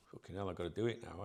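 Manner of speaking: low, quick grumble under the breath; quick, subdued mock grumble; grumbling reluctance. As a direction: imitate a put-upon worker complaining quietly. Voices a reluctant colleague grumbling.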